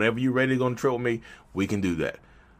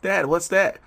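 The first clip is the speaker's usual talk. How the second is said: put-on young Simba; 8 semitones higher, slower than usual.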